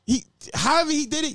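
ordinary talk

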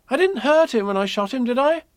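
put-on high childlike boy asking; a little boy's hopeful question; small boy voice. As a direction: voice young Christopher Robin up high. Christopher Robin voiced in story.

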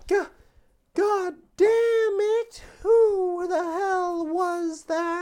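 high, slow furious yell; high shrill outrage. Acted. Prisoner yelling high.

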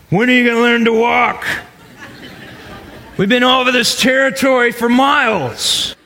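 high, mock-impatient sigh of a fed-up dad; hammed-up exasperated parent; impatient father. Heavenly Father as an impatient parent, mock scolding. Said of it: "is not going".

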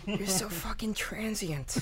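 soft, growled mock insult. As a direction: murmur it like a private joke. Mock insult after whisper.

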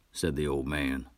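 usual speaking voice

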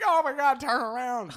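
reliving the horror-movie moment, shrieking; panicked yell. Retold or imitated. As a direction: scream a warning through the glass. Yelling at squirrel through window.

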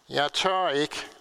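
usual speaking voice

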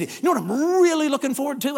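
lingering hard on "really"; loaded emphasis. Retold or imitated. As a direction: mimic a suggestive tease, stretching "really". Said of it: "so he said".

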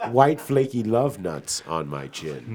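slow, lingering innuendo; slow suggestive. Acted. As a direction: draw out each word teasingly.

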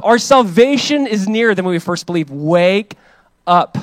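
loud, high preaching that builds to shouted "wake up"; preaching at full force. Acted. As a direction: thunder the call to wake up at the congregation.

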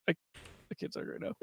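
hushed, breathy whisper with no voice. Retold or imitated. Retelling ducking a sister's Switch demand, whispered. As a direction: whisper so the sister won't catch on. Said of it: "and I'm like".

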